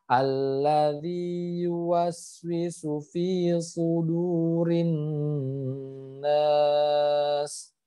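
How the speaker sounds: slow chanted verse, nun held; slow recitation. Shown as a demonstration. Teacher chanting the whole verse. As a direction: recite unhurriedly holding each nun. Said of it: "Jadi harus ditahan".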